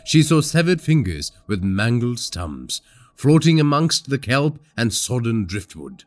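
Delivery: plain reading voice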